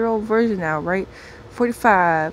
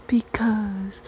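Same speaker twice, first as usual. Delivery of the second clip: breathy whispered because; drawn-out hushed why; whispering; 2 semitones higher, much slower than usual. Acted. Whispered, slowed.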